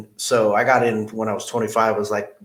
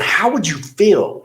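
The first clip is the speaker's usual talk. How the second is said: higher, louder and slower, getting heated; agitated; 8 semitones higher, 5 dB louder than usual. Retold or imitated. Boss confronting struggling salesman, agitated and raised. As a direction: press him, voice rising in irritation. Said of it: "I was getting a little agitated".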